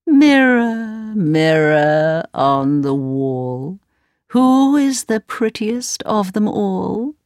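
wicked queen. Acted. Queen asks the magic mirror.

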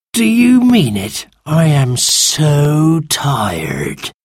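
very slow, drawn-out creature voice; groaning, put-upon magical creature; weary sand fairy. A narrator's reading. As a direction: start high, sag into exhaustion.

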